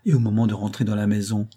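plain reading voice

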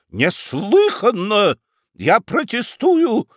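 high, slow shout with a voice cracking. Acted. Prosecutor shouting his protest at the judge: cracking yell. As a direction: yell the protest till the voice breaks. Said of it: "срывающимся голосом кричал Рамкомф".

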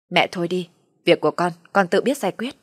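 plain reading voice

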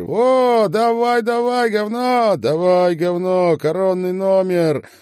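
very high, loud egging-on; loud, excited high shouts; cheering on. A narrator's reading. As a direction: whoop and cheer him on at full voice. Man clapping, cheering singer on.

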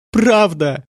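high, fierce confirmation; acted high-pitched outburst; furious cry. A narrator's reading. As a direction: cry it out in frenzied anger. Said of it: "яростно выкрикнула она".

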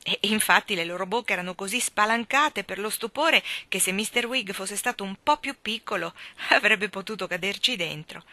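ordinary delivery

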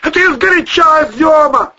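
loud, high-pitched squabbling; shouted retort. Acted. Man snapping at his friend mid-siege, shouting. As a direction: shout back in irritation.

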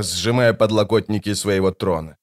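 ordinary delivery

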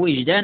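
وجدا with a short i, "wijdan"; i vowel on waw. Shown as a demonstration. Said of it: "مثلث الواو".

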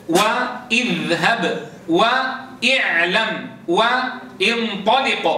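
each word read very slowly, the opening hamza said with a clear i; slow model reading. Shown as a demonstration. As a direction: read اضرب وارجع وامشي slowly, stressing the kasra. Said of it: "الأصل أن نقرأ همزة الوصل". (قراءة بطيئة بكسر همزة الوصل)

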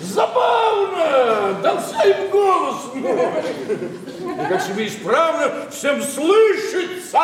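high mocking laughter through the words; sarcastic laugh. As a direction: laugh it off with scorn. Father mocks everyone hearing voice. (насмешливо смеясь, высоко)

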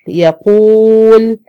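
يقول with the waw held long and loud; long madd on waw. Shown as a demonstration. Teacher demonstrating the waw madd to her students, slow and stretched. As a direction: draw out the long oo in يقول slowly. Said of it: "واو ساكنة ما قبلها مضموم زي مثل".

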